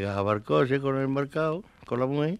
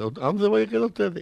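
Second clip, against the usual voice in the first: calling out; 10 semitones higher, 3 dB louder than usual. Retold or imitated. Viudo pregunta dónde bajan.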